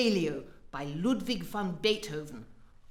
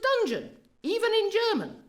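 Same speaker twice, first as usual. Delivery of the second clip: dungeon, even in German, with dungeon flung much higher; scold, pitching dungeon way up; exasperated director correcting the cast; indignant stress; 12 semitones higher, 3 dB louder than usual. Acted. Director scolding about word use.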